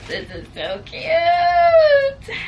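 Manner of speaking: loud, high, drawn-out tearful squeal; overjoyed. Real, not acted. Vlogger overwhelmed by a cute gift, overjoyed. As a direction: squeal it loud and long, near tears.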